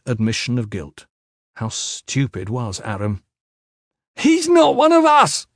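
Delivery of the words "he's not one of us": very high, loud protest; desperate shout. A narrator's reading. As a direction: scream the protest at everyone. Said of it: "Aram shouted".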